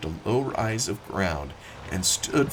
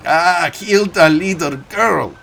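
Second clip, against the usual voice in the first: put-on loud, higher German-accented call; German-accented cry; 8 semitones higher, 10 dB louder than usual. Farmer crying out to the little girl over the machine, German-accented and loud. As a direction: call out sharply to the child. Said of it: "he cried".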